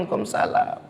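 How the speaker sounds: soft unvoiced answer to an elder; a respectful youngster answering quietly; whispered reply. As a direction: barely breathe the reply. Polite child answering elder.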